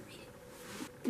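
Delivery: urgent unvoiced whisper of a command; hushed insistent whisper. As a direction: breathe it out like a secret order. Urging viewers to read the book.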